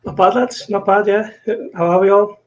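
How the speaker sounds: usual speaking voice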